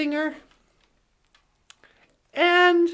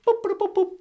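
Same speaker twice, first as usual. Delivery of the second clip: computer beeps; 5 semitones higher, faster than usual. Acted.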